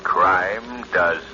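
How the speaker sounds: low slow menacing intonation; ominous tagline. Acted. The Shadow warns listeners that crime does not pay, ominous. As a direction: deliver each word like a warning. Closing catchphrase.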